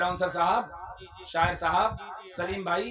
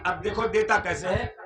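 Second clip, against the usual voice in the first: ordinary delivery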